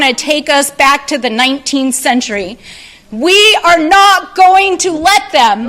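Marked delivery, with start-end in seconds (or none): 3.1-5.7 rising rally cry, building to a shout; rally speech. Real, not acted. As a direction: fire up the crowd.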